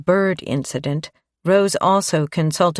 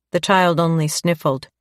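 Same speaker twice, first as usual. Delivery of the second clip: ordinary delivery, nothing marked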